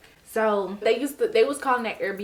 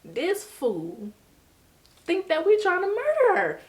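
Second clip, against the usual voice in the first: friend's voice jumping much higher and louder in disbelief; mocking friend; 10 semitones higher, 5 dB louder, slower than usual. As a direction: scoff it like a friend who can't believe this. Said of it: "And he like".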